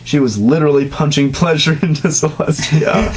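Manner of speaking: ordinary talk, nothing marked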